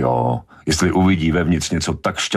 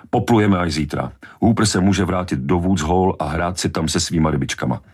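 plain reading voice

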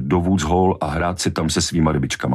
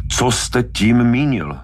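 slow, higher, angry questioning; enraged demand; 7 semitones higher, slower than usual. A narrator's reading. Brody throttling Hooper for an answer, enraged. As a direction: press each word as a furious demand. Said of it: "V tom ho přemohl vztek".

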